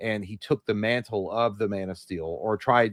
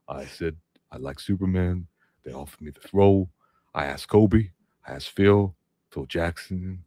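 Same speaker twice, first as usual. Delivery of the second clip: doing Shaq like he's half asleep; Shaq impression; 5 semitones lower than usual. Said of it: "I can't do it".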